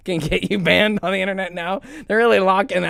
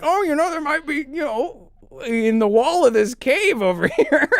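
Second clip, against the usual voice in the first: mocking scientist; 7 semitones higher than usual. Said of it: "and he's like".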